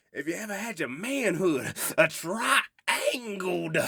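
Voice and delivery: high, loud revival preacher impression, drawn out at the end; hammed-up Southern pulpit holler. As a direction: preach it like a fired-up Southern minister. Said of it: "he's doing, like, the Southern preacher voice".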